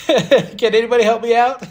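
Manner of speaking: high, loud plea through laughter; joking, laughed-out appeal; laughing plea. Real, not acted. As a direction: beg jokingly while cracking up.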